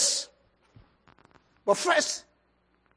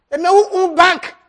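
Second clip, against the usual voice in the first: emphatic preaching; 6 semitones higher, 7 dB louder than usual. Acted.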